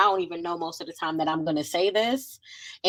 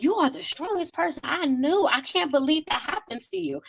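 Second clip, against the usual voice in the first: high-pitched imitation of astonished friends; incredulous quoted reaction; mock disbelief; 8 semitones higher than usual. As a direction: play back their amazement, pitched well up. Quoting amazed people's reaction.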